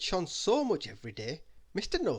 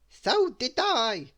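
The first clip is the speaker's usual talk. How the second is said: high, slow, louder silly voice; Mister Silly, leaving the holiday, in a high slow silly voice; silly character voice; 10 semitones higher, 8 dB louder, much slower than usual. Acted.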